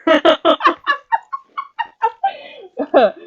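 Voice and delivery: high, helpless laughing fit; real extended giggling; long laugh. Real, not acted. Laughing at own keto joke.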